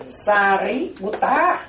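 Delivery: Sariputta very slow, each syllable clear; Pali pronunciation. Shown as a demonstration. Monk modelling the Pali name for the congregation, slow. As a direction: model Sariputta slowly for them to repeat. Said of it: "gán đọc là Sariputta".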